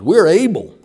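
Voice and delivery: loud, confident reply; bold declaration. Retold or imitated. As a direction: proclaim it boldly. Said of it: "boldly responded".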